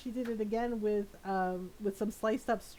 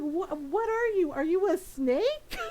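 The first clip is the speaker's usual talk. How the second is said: incredulous; 6 semitones higher, 4 dB louder than usual. Retold or imitated. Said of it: "He's like".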